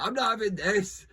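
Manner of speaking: high, loud, hammed-up indignation; indignant outburst. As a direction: fling it out like a fed-up bloke at a jumble sale. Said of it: "And he was like".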